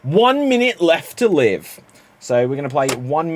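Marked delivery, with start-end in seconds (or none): 0.0-1.8 high, slow, louder game-title announcement; announcer reveal. Acted. Host revealing the improv game to the audience, announcer-style. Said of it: "let's see what the first game".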